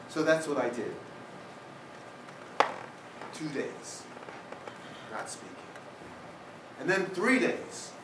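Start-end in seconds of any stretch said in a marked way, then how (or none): 3.3-5.5 hushed. Real, not acted. Quiet counting of silent days.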